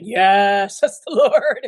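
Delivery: a thrilled, laughing voice of the spirit; joyful laughing yes. Retold or imitated. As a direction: let the joy burst out as it dawns on you. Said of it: "my spirit was saying".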